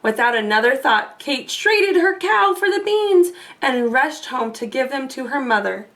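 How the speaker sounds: ordinary delivery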